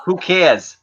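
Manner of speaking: slow, drawn-out New England vowels; New England accent. Pretend. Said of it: "is that your New England accent".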